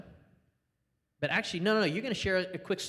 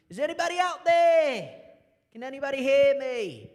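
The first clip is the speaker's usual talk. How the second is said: high, loud British sea-captain call; hammed-up British search shout; British accent; 11 semitones higher, 8 dB louder than usual. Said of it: "It's my best British accent".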